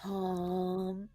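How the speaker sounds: a soft, hushed "Calm, calm" mimicking the owner; imitating soothing "calm". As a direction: breathe out the word calm very softly, half-mocking. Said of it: "and she's going".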